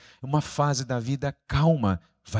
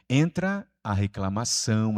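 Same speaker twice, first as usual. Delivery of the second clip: normal talking voice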